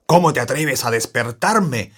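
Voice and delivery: rugido enfurecido. Acted. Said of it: "rugió enfurecido el león".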